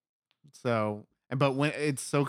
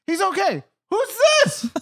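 very high, loud prying question; jealous woman demanding who it is; high suspicious voice; 9 dB louder than usual. Retold or imitated. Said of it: "she's like".

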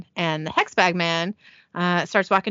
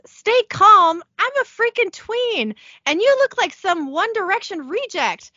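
high, indignant freak-out; panicked outburst; 11 semitones higher, 4 dB louder than usual. Acted. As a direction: throw it back incredulously, voice pitched way up. Tina's panicked outburst in recap.

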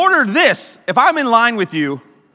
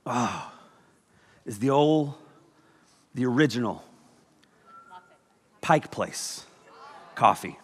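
hushed reverence; 10 semitones lower, 10 dB quieter than usual. Pretend. Reverent pause over coffee.

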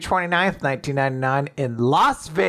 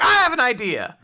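sly eager; 8 semitones higher, faster than usual. Retold or imitated. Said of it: "Fuko's just like".

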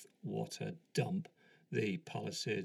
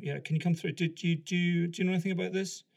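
raised, higher voice called to a back room; calling through; 8 semitones higher, 7 dB louder, faster than usual. Retold or imitated. Sergeant calls through to the other sergeant, calling out. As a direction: call out to a colleague out back. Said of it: "the sergeant called through to the office".

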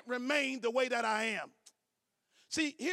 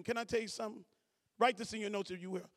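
normal talking voice

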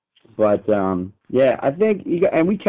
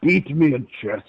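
Russian accent. Shown as a demonstration. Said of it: "That's Klingon".

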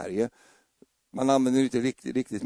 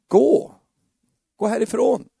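shooing away; 8 semitones higher, 6 dB louder than usual. Retold or imitated. Husband shoos wife away.

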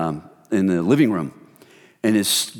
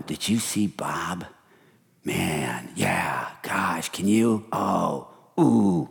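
gossipy hush; 6 semitones lower, 5 dB quieter, much slower than usual. Retold or imitated. Gossiping complainers in office.

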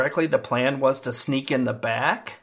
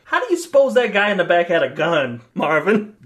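louder, higher, threatening, gun raised; menacing gangster line; 6 semitones higher, 7 dB louder than usual. Acted. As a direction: lean over the seat and threaten him. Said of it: "and be like".

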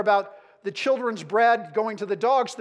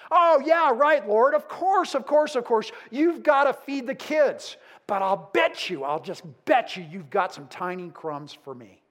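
spar with him, teasing and cheeky; sassy attitude; 3 dB louder than usual. Retold or imitated. Mother answering Jesus back with playful sass. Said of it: "It's almost like she's got some attitude going".

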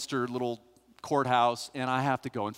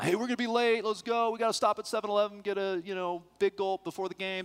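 high, eager mock pleading from the buddies; friends egging on; 6 semitones higher, faster than usual. Buddies in the car egging on the driver, high and eager. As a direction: pester the driver to hurry, voice pitched up. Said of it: "egging me on".

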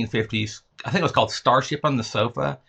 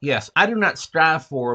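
ordinary talk

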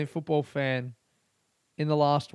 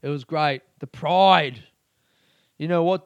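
ordinary talk

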